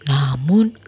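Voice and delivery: ordinary delivery, nothing marked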